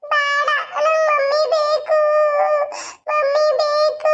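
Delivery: put-on high, slow sobbing little boy's voice; crying child. Boy crying to his father that he wants mummy, high sobbing child voice. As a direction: cry it out like a small child who lost his mother.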